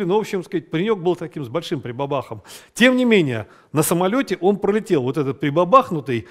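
normal talking voice

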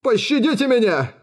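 high-pitched cry for mercy; terrified captive's plea; pleading. A narrator's reading. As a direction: beg desperately, voice pushed up. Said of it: "взмолился бродяга".

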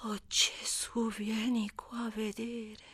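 slow whispered gasp at the sight; awed whisper. Acted. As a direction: breathe it out, stunned. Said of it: "esclamò". (sussurro lento e sbalordito)

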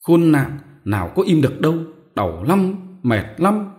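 high, loud cries of a woman in labour; moaning in pain. Acted. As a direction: wail it out through the pain. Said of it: "vợ mếu máo kêu". (rên rỉ đau đớn, cao và to)

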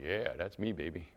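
smug husband. Retold or imitated. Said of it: "The proud husbands are going".

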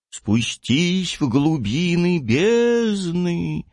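slow, high, otherworldly eerie intonation; eerie sepulchral voice. Acted. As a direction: drag it out like a voice from the grave. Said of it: "самым жутким и загробным голосом провозгласила".

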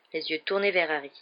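ordinary delivery, nothing marked

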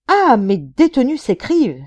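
stammering with anger; furious outburst. A narrator's reading. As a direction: explode with outrage. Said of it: "s'écria-t-il, bégayant de colère".

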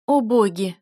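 loud, distressed exclamation; understated mother's sob; mild sob. A narrator's reading. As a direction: exclaim in dismay, a touch tearful. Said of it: "всхлипнула матушка".

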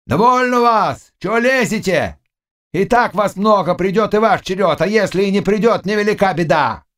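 high, scolding yell; shouting. A narrator's reading. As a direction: shout the crowd back. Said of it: "и закричал".

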